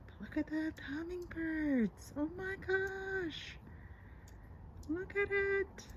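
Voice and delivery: high, slow gushing delight; thrilled squeal. Real, not acted. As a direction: coo over the bird in awe. Thrilled at seeing hummingbirds.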